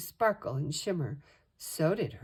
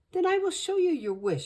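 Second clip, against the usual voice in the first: high, shaky-voiced fearful genie; voice shaking; 14 semitones higher, 5 dB louder than usual. A narrator's reading. Genie nervously granting the wish, voice shaking. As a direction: say it with a trembling, scared voice. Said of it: "her voice shaking".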